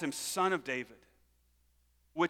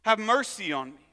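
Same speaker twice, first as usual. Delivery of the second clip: raised, higher-pitched acted plea; crying out; 4 semitones higher, 6 dB louder than usual. Blind beggar appeals to the Messiah, crying out. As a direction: call out for help in punched, separate appeals. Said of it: "that he cries out".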